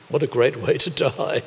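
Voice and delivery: ordinary talk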